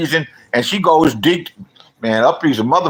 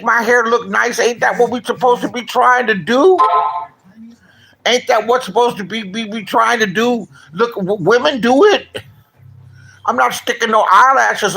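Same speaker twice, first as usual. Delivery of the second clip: higher, heated rant at the critics; indignant rant; 9 semitones higher than usual. Real, not acted. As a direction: push back hard, fed up and defensive. Ranting back at hair critics.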